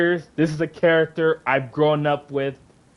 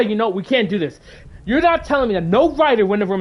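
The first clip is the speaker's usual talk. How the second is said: angry, incredulous tirade, pitched up; pissed off; 6 semitones higher than usual. Real, not acted. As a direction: rail at them in real outrage. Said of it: "It just pisses me off".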